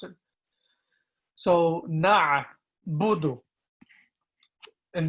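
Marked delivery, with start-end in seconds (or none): 1.9-3.4 "na'budu" drawn out, throat "a" stressed; deliberate teaching "na'budu"; very slow model. Shown as a demonstration. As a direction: slowly sound out "na'budu" from the throat. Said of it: "requiring extra effort".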